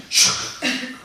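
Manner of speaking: sharp breathy swoosh; whoosh sound. Acted. Waves suddenly calming.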